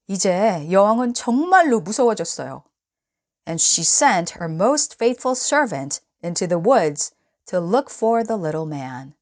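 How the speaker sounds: usual speaking voice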